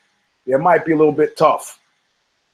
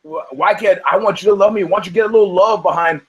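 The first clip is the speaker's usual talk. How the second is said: pleading fighter; 4 semitones higher than usual. Retold or imitated. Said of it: "he's, like, saying".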